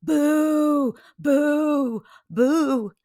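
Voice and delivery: high, drawn-out boos; imitated heckling, loud and high; loud booing. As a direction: jeer like a booing crowd. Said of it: "all I hear is".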